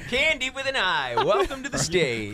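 lightly voiced club emcee; strip club MC voice. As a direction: welcome the dancer to the stage. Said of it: "It's turned into a strip club M C".

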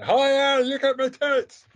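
loud, high-pitched mock Scottish cry; Scottish accent. Joking about David Tennant to a co-host, in a Scottish accent. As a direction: shout it in a daft Scottish accent. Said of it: "came out with a Scottish accent".

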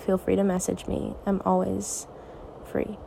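normal talking voice

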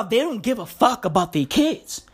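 normal talking voice

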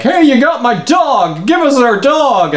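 high-pitched mock yell; group calling out. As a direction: act out a crowd demanding it. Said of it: "they all run up to them and they're like".

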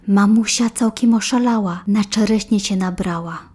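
ordinary delivery, nothing marked

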